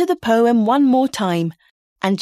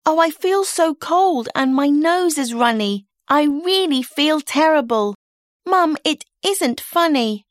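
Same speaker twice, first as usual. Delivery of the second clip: put-on higher, whiny child pleading to stay home; sick girl whining; 4 semitones higher than usual. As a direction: play the sick kid begging mum. Child May complains she is sick.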